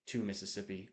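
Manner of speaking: soft counting under the breath; hushed one-Mississippi count; counting in your head. Shown as a demonstration. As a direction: count it quietly as if to yourself. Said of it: "count in your head".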